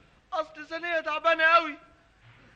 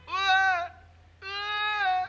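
high mock newborn wail; baby crying imitation; 6 semitones higher, much slower than usual. Husband faking his baby's cry down the phone, high wail. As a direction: wail like a tiny newborn, pitched way up. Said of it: "سامع يا فؤاد؟".

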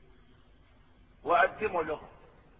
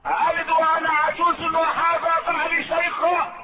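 astonished; 7 semitones higher, 3 dB louder than usual. Acted. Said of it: "Sara's astonishment".

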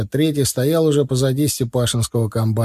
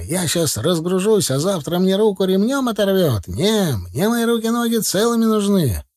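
high, protesting voice; mildly angry yell; 8 semitones higher than usual. A narrator's reading. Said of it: "заорал Степашин злобно". (возмущённо, высоким голосом, без настоящего крика)

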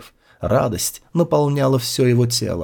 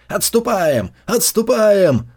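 shouted retreat; 7 semitones higher, slower than usual. A narrator's reading. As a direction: bellow the retreat across the field. Order repeated by riders.

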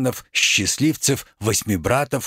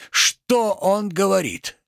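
higher, drawn-out incredulous question; escalating outrage; 5 semitones higher, slower than usual. Acted. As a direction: stretch the question up in theatrical disbelief. Tragedian repeats question, more outraged.

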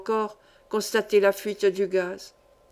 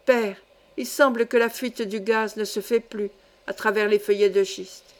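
usual speaking voice

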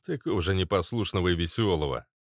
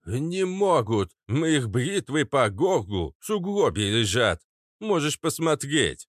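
boastful Kats; 6 semitones higher than usual. Acted. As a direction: drag it out with smug assurance. Papa Kats boasts of killing yetis.

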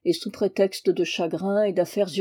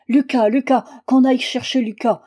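loud, higher calling out for help; frantic cry; 4 semitones higher, 6 dB louder than usual. A narrator's reading. Mother crying out for Lucas over her fallen husband, loud and frantic. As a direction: shout the son's name in panic. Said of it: "cria la mère".

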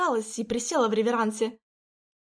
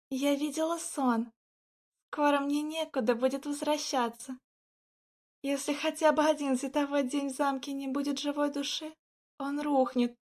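hushed; 4 semitones higher, 5 dB quieter than usual. Acted. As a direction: breathe it out, quiet and grave. Lauren tells grim dream.

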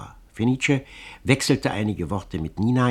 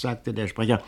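ordinary delivery

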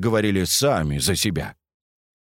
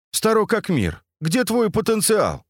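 put-on high, teasing young woman voice; lightly mock the joker; teasing girl; 11 semitones higher than usual. Katya teasing Andrey.

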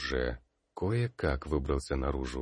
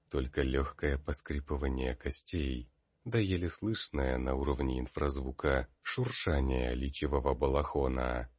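ordinary delivery, nothing marked

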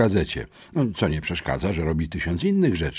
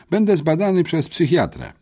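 visibly pleased; 7 semitones higher than usual. A narrator's reading. Boy announcing his psychiatrist visit, pleased. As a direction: announce it like good news. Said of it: "oznajmił wyraźnie ucieszony".

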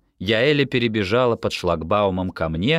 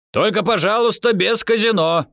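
high, raised voice calling back; shouted parting call; 13 semitones higher, 5 dB louder than usual. A narrator's reading. Amihai boarding the bus calls back, shouting. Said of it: "прокричала Михай".

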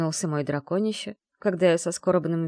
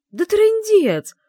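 very high, loud, slow exclamation; exasperated outburst; 11 dB louder, much slower than usual. Acted. Bride balking at the wedding rules, exasperated shout. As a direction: blurt it out in horror. Heroine panics about the ceremony.

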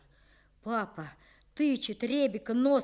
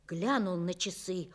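ordinary delivery, nothing marked

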